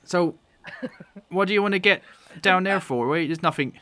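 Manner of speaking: puzzled farmer quizzing strangers; halfling farm woman. Acted. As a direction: question them as a suspicious halfling farmer. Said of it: "a halfling woman".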